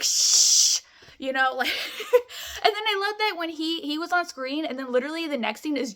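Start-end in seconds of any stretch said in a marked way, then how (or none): 0.0-0.8 loud unvoiced shush; hushing. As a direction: shush a noisy moviegoer. People hushing in theater.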